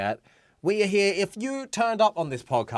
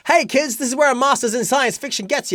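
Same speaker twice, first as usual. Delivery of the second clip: mock TV pitch; 8 semitones higher, 8 dB louder, faster than usual. Mock advice to viewers, loud.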